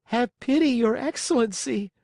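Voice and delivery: high, begging puppet voice; pitiful plea. Acted. Puppet begging the showman for pity, high and pitiful. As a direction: plead for mercy like a small child. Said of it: "in a pitiful voice".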